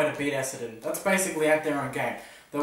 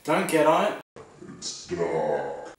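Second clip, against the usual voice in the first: low, menacing dark voice; vicious dark side; 9 semitones lower, much slower than usual. Pretend. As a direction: growl it like a villain. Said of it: "that was [name]'s vicious side".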